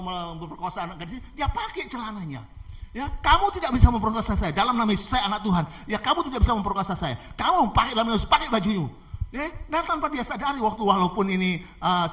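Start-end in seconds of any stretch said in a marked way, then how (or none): 3.1-8.9 loud, insistent rebuke; commanding. Acted. Girl ordering attacker to get dressed, commanding. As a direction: hammer each declaration home.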